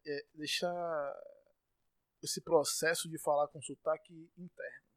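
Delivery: normal talking voice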